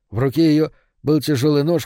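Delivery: usual speaking voice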